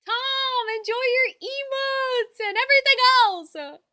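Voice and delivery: high, loud drawn-out shout to a viewer; exuberant call-out. Real, not acted. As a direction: holler it out with big enthusiasm. Calling out to gifting viewer.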